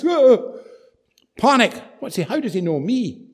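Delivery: sudden acted shock, pitched high; mock panic. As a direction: jolt with hammed-up alarm. Acts out Zacchaeus's startled panic.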